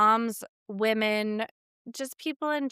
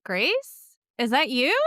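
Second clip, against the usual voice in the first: mock greeting; 4 semitones higher, 5 dB louder than usual. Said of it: "look them in the eye and say".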